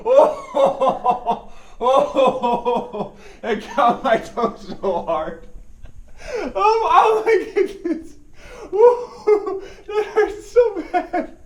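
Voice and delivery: high pained giggling while talking; laughing through pain. Real, not acted. Trap snaps his toe, laughing.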